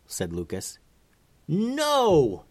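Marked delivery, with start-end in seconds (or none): 1.5-2.4 high, drawn-out cry of refusal; alarmed yell, pitched way up. A narrator's reading. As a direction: cut in with an urgent, high "no". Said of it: "yelled Pierce".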